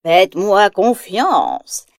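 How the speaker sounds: put-on slow, sly old witch voice; mischievous witch. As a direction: purr it with a crafty smile. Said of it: "d'un sourire malicieux".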